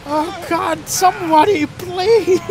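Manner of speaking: high, strained begging; pleading for help, half laughing; desperate plea. Real, not acted. Streamer struggling with game controls.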